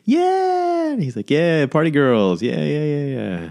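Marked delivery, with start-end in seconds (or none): 0.0-0.9 squealing "Yeah!"; imitating a shrill crowd squeal; high-pitched girl cheer. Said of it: "a real high-pitched voice". 1.3-3.5 high, slow hyped "yeah"; imitating a hype-man; mock party hype. Comedian hyping young girls.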